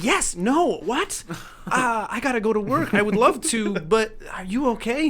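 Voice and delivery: high, loud, tumbling confusion; flustered disbelief. Retold or imitated. The flustered driver answers the stoner's offer, high and loud. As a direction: stammer it out, thrown and high.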